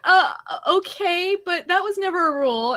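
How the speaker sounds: put-on high-pitched child objecting; protesting kid. Child protests mom's new rule.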